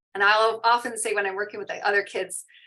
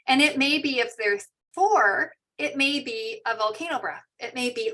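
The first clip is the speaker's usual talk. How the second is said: usual speaking voice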